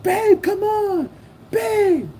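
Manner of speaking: very high, drawn-out falsetto plea; imitated girlfriend calling. Rival's lady calling him to the car, high falsetto. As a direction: mimic a woman calling her man back.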